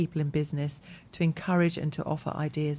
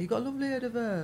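put-on fast, higher and softer local accent; quicker, higher East Midlands accent; mock East Midlands; 6 semitones higher, 5 dB quieter, much faster than usual. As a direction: say it again like a cheeky local. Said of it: "I think that's what they say".

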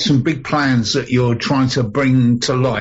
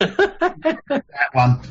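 laughing; 8 semitones higher than usual. Real, not acted. Laughing at Jules joke.

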